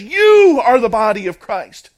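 "You" held long, high and very loud; emphatic. Retold or imitated. Preacher quoting Paul to the believers, emphatic. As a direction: belt out "You" as the key word. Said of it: "in a very emphatic way".

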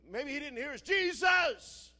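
loud, high-pitched desperate yell; screaming. Acted. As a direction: call desperately to someone who hasn't heard. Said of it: "They're screaming".

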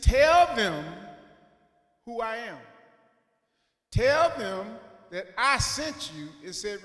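loud, firm divine command; God thundering back his answer; booming God. Retold or imitated. God answering Moses with a loud, firm command. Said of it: "He said".

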